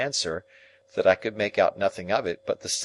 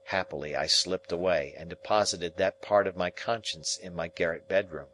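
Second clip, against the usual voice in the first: ordinary delivery, nothing marked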